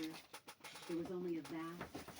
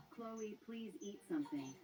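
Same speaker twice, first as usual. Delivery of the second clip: slow, gentle plea, higher; pleading; 5 semitones higher than usual. A narrator's reading. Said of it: "he'd plead".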